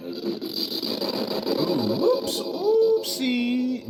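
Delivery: very high, slow, drawn-out whoops; a cutesy drawn-out oopsie; squeaky oopsie. Real, not acted. Mistake with tones left on.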